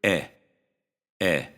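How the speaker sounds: eh, short and quick; short eh demo. Shown as a demonstration. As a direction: clip the vowel to eh. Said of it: "pronounce it like a short".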